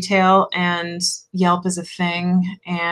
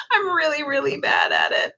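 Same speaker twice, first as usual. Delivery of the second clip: hammed-up cry-shout lament; a touch of fake sobbing; mock wail; 7 semitones higher than usual. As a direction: wail the confession in play. Mock lament about bad shop-keeping.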